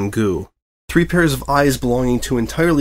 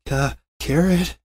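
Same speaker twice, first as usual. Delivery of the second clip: stammering dread; 6 semitones higher, slower than usual. A narrator's reading. Reporter dreads carrots.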